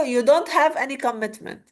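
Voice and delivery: normal talking voice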